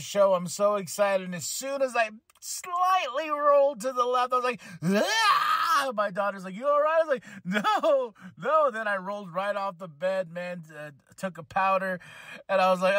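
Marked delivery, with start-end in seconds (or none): 4.8-5.9 high yelp of back pain; retold sharp yelp from rolling over; pained cry. 6.6-7.0 higher, louder worried daughter; daughter's concern. Retold or imitated. Daughter voiced in story. 7.4-8.7 pained no. Retold or imitated. Retelling pain in bed.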